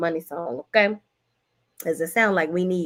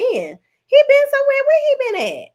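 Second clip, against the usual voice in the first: playful teasing; 7 dB louder than usual. Real, not acted. Teasing about an absent friend.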